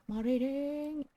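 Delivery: a playful whine at the text; mock groan. Real, not acted. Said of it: "Oh no, more reading".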